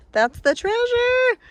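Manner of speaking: high, slow, drawn-out exclamation; excited sing-song. Real, not acted. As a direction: sing it out with delight.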